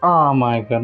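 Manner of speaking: ordinary talk, nothing marked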